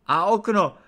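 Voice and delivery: high, very loud yell; irritated shout. A narrator's reading. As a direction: bellow it just before a sneeze. Sneezing lord yells about window.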